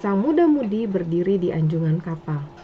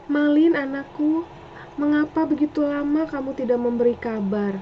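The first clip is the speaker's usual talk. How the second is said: higher, aching mother calling her son; longing old mother; 7 semitones higher than usual. Acted. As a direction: cry out to a long-lost son. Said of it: "kata Ibu Malin Kundang".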